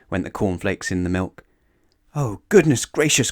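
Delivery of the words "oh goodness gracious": high, louder cry of alarm. A narrator's reading. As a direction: cry out as you dive for cover. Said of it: "cried Mister Jelly".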